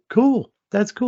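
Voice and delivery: high, bright mock-upbeat cool; forced cheer. Dad answering his son with high forced cheer. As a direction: fake enthusiasm to hide disappointment. Dad's reply to son, retold.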